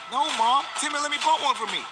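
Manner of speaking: put-on a kid blaming someone else to his mom; whiny kid Jason. Kid whining to his mom, pointing the finger.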